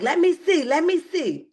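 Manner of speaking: high, insistent mimicked plea; eager demanding. Tisha pressing Martell for proof, insistent imitated demand. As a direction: pitch it up, pressing to see.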